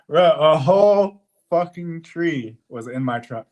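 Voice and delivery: slow, high, loud amazement; amazed. Real, not acted. Driver telling the camera about the tree, slow amazed. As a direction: stress how huge this was.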